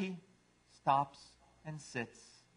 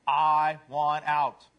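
slow, loud mock cat scream; 8 dB louder, much slower than usual. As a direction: drag out each word, loudly. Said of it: "silently screams to the world".

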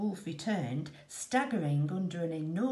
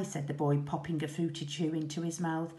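plain reading voice

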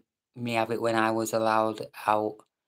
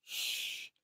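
a long soft shh to hush; shushing; 12 dB quieter than usual. Real, not acted. Telling chat the wife is asleep, shushing. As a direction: hush everyone like someone's asleep. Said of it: "the missus is in bed".

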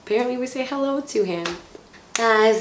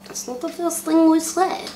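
pitched way up in disbelief; high squeaky disbelief; 7 semitones higher than usual. Real, not acted. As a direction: squeak it out, baffled. Blooper reaction, high pitched.

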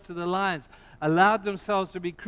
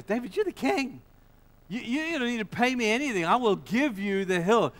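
a hint of an eager landowner, higher; eager offer, lightly voiced; Araunah voiced; 7 semitones higher, 3 dB louder than usual. Preacher voices Araunah pleading.

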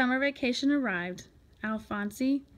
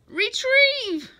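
loud, very high drawn-out call; calling a command; 12 semitones higher, 7 dB louder than usual. A narrator's reading. Boy sending his tadpole for treasure, calling a command. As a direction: shout the order to the pet.

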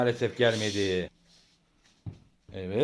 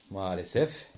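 ordinary talk, nothing marked

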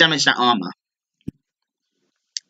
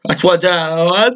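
slow words through a yawn; 3 semitones higher, slower than usual. Real, not acted. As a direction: talk while yawning, slowly. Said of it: "Sorry for yawning".

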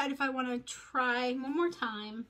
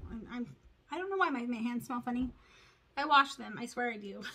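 ordinary delivery

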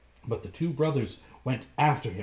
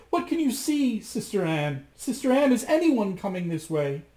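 much higher, louder anxious call; crying up to her sister; 9 semitones higher, 4 dB louder than usual. A narrator's reading. Frightened wife calling up to Sister Anne. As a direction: call up the tower, desperate. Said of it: "cried up to her".

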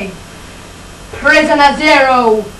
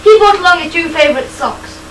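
ordinary delivery

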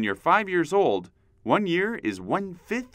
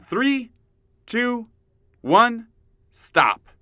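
loud countdown; 9 semitones higher, 5 dB louder, much slower than usual. Acted. Counting down exercise.

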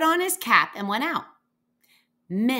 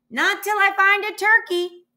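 calling back; 10 semitones higher, 8 dB louder than usual. A narrator's reading. Answering a call across distance.

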